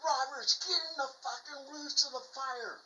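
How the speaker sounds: high-pitched imitated captain's yell; acted-out shouted command; retold yelling. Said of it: "the captain's yelling at me".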